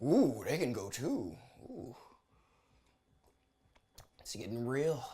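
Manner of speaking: low, soft, slow relish; hushed, impressed ooh; savoring the drama. Real, not acted. Host watching the judge eject people, savoring it.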